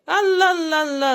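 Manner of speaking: a sudden high, loud burst of delight; excited cheer. Real, not acted. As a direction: cheer at the prize as it appears. Excited prize reveal while unboxing. (هتاف فرحان مفاجئ)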